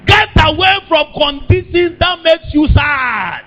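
high, urgent pulpit cry; impassioned preaching. Real, not acted. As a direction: exhort the congregation, drawing out the end. Preacher exhorts congregation at full pitch.

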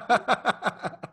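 bursts out in a high laugh; a sudden high laugh at the story. Real, not acted.

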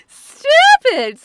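loud, high shout through laughter; delighted high-pitched outburst; real cracking up. Real, not acted. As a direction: shriek it while laughing hard. Listener cracks up at the joke.

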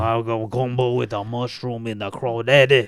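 thick put-on Cajun accent. Cajun accent, joking with his podcast cohost. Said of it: "you do a fantastic Cajun accent".

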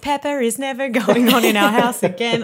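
mock parental outrage. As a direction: lay down the law in mock horror. Acted parents' verdict on Peppa.

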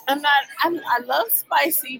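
ordinary delivery

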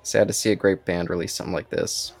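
usual speaking voice

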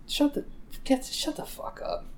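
high, thin-voiced put-down; mock dismissive scolding. Dad to parents complaining online about kids, mock scolding. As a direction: dismiss them in a high, half-voiced jeer.

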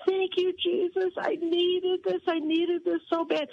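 high, drawn-out imitation of tearful thanks; mock tearful gratitude. Doctor overcome during prayer at dental office, imitated tearful gratitude. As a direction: retell a tearful cry of thanks, pitched well up. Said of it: "falls in a puddle of tears".

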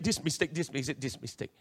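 low, soft, repetitive listing; examiner listing faults. Retold or imitated. Examiner telling learner his test mistakes, low-key.

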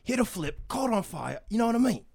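a loud, high Jason Statham impression. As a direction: roar it like a British action hero. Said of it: "this is my impression of, uh, Jason Statham".

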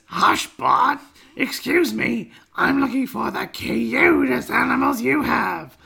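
high, strained cartoon mother voice; Marge. Co-host reading Marge's pet shop lines, high put-on mother voice. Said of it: "And then Marge says".

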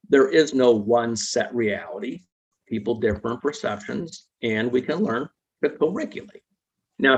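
ordinary delivery

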